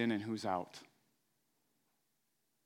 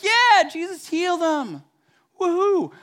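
much higher, louder, slow joyful whoop; cheering crowd; 12 dB louder, slower than usual. Retold or imitated. Amazed crowd cheering as the man walks, whooping. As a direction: celebrate like you just saw a miracle.

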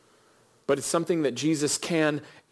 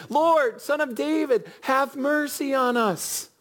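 high, pleading cry pushed further after being shushed; shouted plea, even higher; 10 semitones higher, slower than usual. Retold or imitated. Blind men shouting to Jesus after being shushed, higher desperate plea. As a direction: refuse to be silenced, cry out again. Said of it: "They even get shushed".

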